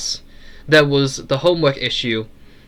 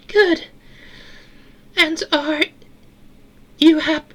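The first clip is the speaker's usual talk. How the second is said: tiny tinny voice, pitched way up; Eleanor girl voice. A narrator's reading. Eleanor asking Sarah about her promise, tinny little girl voice.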